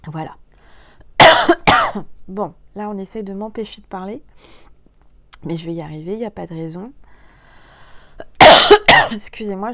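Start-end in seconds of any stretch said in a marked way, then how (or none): 1.0-2.2 two hard, loud coughs; loud coughing burst into the microphone; real coughing. Real, not acted. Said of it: "je tousse dans le micro". 7.8-9.1 very loud hacking cough; hard cough to free the throat; real coughing. Real, not acted. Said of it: "je tousse dans le micro".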